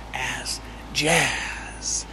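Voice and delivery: breathy whispered reveal of jazz. Acted. Teacher teasing the class about jazz, whispered. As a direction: hush it like a secret.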